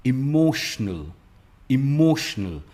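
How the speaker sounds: Emotional slow, mo stressed. Shown as a demonstration. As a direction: lean on मो in emotional. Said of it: "मो पे इसका stress आता है". (Emotional में मो पर ज़ोर)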